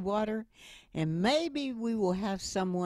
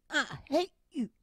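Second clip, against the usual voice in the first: put-on angry child; 9 semitones higher than usual. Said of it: "saying, going out the door".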